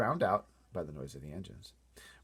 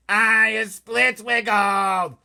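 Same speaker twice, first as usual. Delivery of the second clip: much higher, much louder, slow giant yell; giant's yell; 13 semitones higher, 16 dB louder, much slower than usual. Acted. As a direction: yell it like a furious tied-up giant. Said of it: "yelled the chow chewer".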